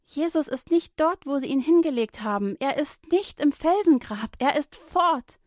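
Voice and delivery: high and loud, breathless; excited telling. A narrator's reading. Women telling of the empty tomb, excited. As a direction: rush out the astonishing news.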